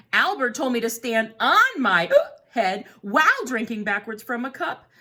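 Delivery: lightly voiced, hiccup-plagued penguin; fussy penguin asking for help; a hint of a flustered penguin; chatty penguin. Voices penguin narrator of book.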